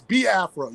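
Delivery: "Biafra" slowly as bee-AF-ra, with an E sound; correct pronunciation. Shown as a demonstration. Guest wrapping up his lesson, slow bee-AF-ra. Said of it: "So it's".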